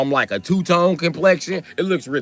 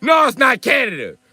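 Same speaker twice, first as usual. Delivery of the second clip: raised, high exasperated denial; exasperated correction; 7 semitones higher, 4 dB louder than usual. Retold or imitated. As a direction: correct them with an indignant jump in pitch. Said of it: "y'all say that just to make me mad".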